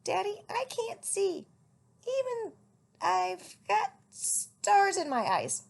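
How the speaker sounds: high little owlet voice. A narrator's reading. Little owlet telling his father. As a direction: play the baby owl, small and high.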